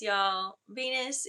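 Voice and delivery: ordinary talk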